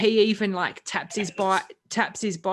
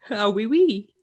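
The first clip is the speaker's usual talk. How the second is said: higher, louder mock French accent; 4 semitones higher, 7 dB louder, slower than usual. Shown as a demonstration. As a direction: play up a comic French accent. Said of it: "you can do a French accent".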